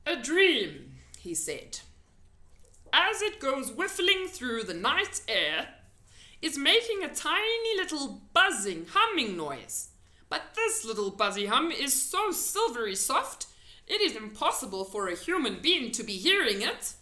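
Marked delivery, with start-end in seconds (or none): 0.1-1.0 loud, folksy friendly giant. A narrator's reading. 2.9-16.9 loud, folksy friendly giant. A narrator's reading.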